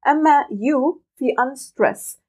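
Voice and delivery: usual speaking voice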